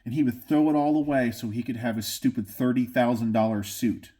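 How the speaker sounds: ordinary talk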